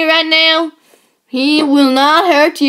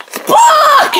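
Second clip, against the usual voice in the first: very high shout then cackle; high hammed-up monster shriek; 4 dB louder than usual.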